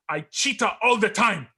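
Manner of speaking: imitated Jamaican accent, pitched high; corny ad line in a put-on Jamaican accent; heavy Jamaican accent. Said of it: "he's got this heavy Jamaican accent".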